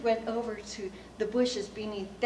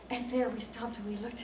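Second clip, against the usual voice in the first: ordinary delivery, nothing marked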